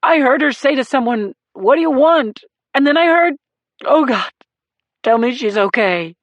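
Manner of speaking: high, loud, tearful rush; crying. A narrator's reading. Husband begging the sergeant for news, crying. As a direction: pour it out while crying. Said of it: "as Marone cried".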